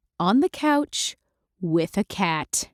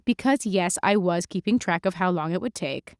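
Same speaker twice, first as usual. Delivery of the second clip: plain reading voice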